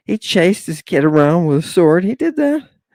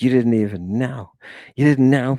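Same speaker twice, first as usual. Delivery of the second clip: ordinary talk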